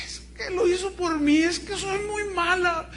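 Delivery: put-on high, tearful little girl voice; a child sobbing to her mother. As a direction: speak as a crying child full of guilt. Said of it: "escuchar a mi hija sollozando".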